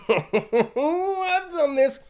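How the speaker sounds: very slow, high, gloating with a laugh; smug Tom. Retold or imitated. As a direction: savour it with a chuckle. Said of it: "Tom's just there on her shoulder being like".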